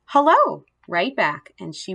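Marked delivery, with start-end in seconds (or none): 0.1-0.7 high, louder squeaky greeting; hamster squeak. A narrator's reading. Hamster answers the mother right back in a high squeak. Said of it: "I squeaked".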